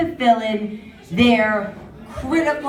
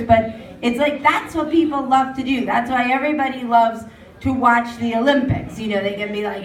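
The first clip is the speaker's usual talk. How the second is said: usual speaking voice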